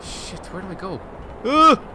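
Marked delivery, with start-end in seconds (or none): 1.5-1.9 loud, high frustrated grunt; frustrated groan. Real, not acted. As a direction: let out an annoyed grunt. Frustrated at game.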